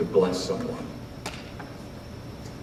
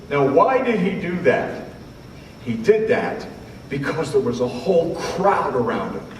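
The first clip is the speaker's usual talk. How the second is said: ordinary delivery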